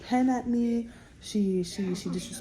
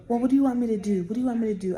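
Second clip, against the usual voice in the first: imitating a snippy nurse, louder; mock snippy nurse; 4 semitones higher, 6 dB louder, faster than usual. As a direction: throw back her attitude, a bit louder. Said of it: "she had an attitude".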